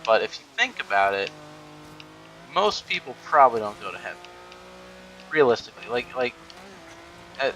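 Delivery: usual speaking voice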